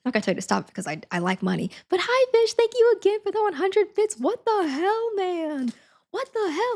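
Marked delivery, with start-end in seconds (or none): 1.9-6.7 excited squeal. Real, not acted. Squealing thanks for donated bits.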